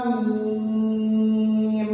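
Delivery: slow, drawn-out melodic chant; Quran recitation. Shown as a demonstration. Teacher reciting letters for students, slow melodic chant. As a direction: stretch each letter name like tajweed recitation. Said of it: "इसे हम पढ़ेंगे".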